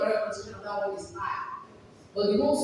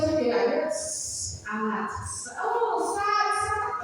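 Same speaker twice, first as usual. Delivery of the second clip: higher voice rising into discovery; excited child; 10 semitones higher, much slower than usual. Acted. Child finally getting the blended word, excited. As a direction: work it out, then burst out high. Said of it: "and then they're excited".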